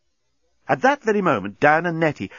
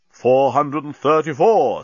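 slow, loud countdown; a hint of a machine counting; lightly voiced counting bomb; 3 semitones higher, 5 dB louder, slower than usual. A narrator's reading. Bomb counting down beside Nettie, slow and deliberate.

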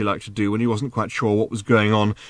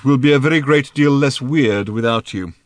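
louder, portentous declaration; pronouncing; 3 semitones higher, 6 dB louder than usual. A narrator's reading. Thrashbarg giving Arthur his verdict, pronouncing portentously. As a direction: deliver it as a pious verdict. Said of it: "he pronounced".

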